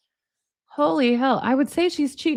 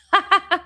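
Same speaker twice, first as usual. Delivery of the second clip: high laugh; 9 semitones higher, 3 dB louder than usual. Real, not acted. Laughs at joke.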